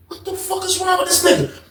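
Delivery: high, hushed incredulous question; onlookers' disbelief. Retold or imitated.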